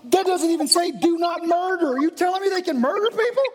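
high, rising, frantic questions; mock panic. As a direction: voice climbs as the panic builds.

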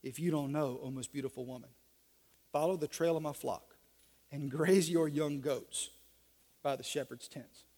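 very low, soft seductive croon-talk. Acted.

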